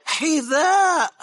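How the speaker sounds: slow, loud, drawn-out cry; despairing wail. Acted. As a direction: beg in total exasperation. Owner's despairing plea to dog.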